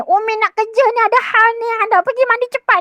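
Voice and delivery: rising temper. Retold or imitated. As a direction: scold the child as patience runs out. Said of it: "dah mula naik".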